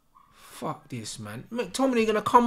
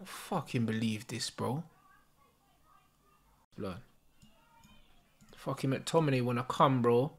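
soft, low disgusted grumbling under the breath; bitter muttering; 7 semitones lower, 9 dB quieter than usual. Real, not acted. Fan sulking after his team concedes, soft bitter muttering. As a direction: mutter it to yourself, beaten.

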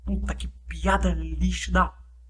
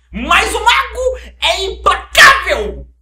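slow, much higher triumphant yell; shouted slow proclamation; 12 semitones higher, 10 dB louder, much slower than usual. Pretend. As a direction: bellow it like a wizard. Clímax do bordão. (proclamação gritada e lenta)

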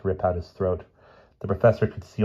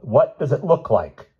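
crabby retort; 6 semitones higher, 4 dB louder, slower than usual. Acted. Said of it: "he retorted in a crabby voice".

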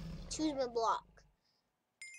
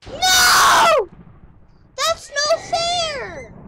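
very high, loud, drawn-out wail; outraged scream; 17 dB louder, much slower than usual. Real, not acted. Gamer who just lost, screaming that it's unfair. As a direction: scream in protest, stretching the words. Loses in the game.

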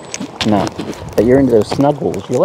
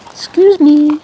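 high indignant excuse me; 13 semitones higher, 5 dB louder, slower than usual. Real, not acted. Bird in the way.

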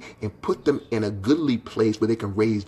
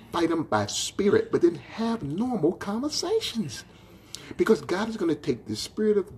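high preaching; 9 semitones higher than usual. Acted. Pastor urging his church, high impassioned preaching. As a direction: pitch leaps high, pleading with the church.

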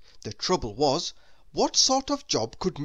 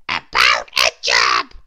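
tiny character voice, louder and airy; Mister Small's voice; 12 dB louder than usual. Acted. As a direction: voice the little man with a strained, airy tone.

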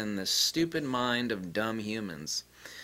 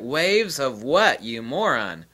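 louder, slowed, mocking demand; scornful shout; 3 semitones higher, 6 dB louder, slower than usual. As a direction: drag it out with contempt. Repeating his retort.

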